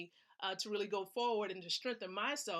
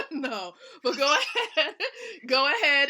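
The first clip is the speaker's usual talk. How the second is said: laughing; 4 semitones higher, 10 dB louder than usual. Real, not acted. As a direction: laugh through the words, louder. Laughing while urging viewers.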